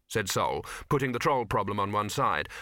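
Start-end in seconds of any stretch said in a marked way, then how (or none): none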